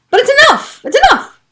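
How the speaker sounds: very high, loud cry of enough; real exasperation. Real, not acted. Insisting to viewers she has had enough, real anger. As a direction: break out in pitch on enough. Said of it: "I feel emotional about it right now".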